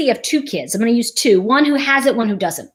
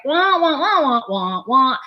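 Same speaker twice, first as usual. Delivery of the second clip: muffled trombone wah-wah imitating a droning parent; Charlie Brown adult voice; 2 semitones higher than usual. Mimicking mom as a distracted kid hears her, wah-wah drone. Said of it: "It's like Charlie Brown".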